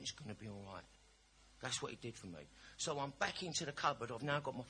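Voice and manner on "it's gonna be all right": low, soft comforting words; quiet, tender imitation; retold soothing reassurance. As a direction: voice the bottle as a gentle comforter. Bottle comforts him like a parent.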